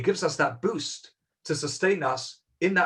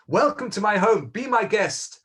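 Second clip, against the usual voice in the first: higher, louder welcoming host voice; hospitable host; 6 semitones higher, 4 dB louder than usual. Retold or imitated. Wealthy old man welcoming guests to his home, a raised hearty greeting. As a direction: throw the doors open for guests.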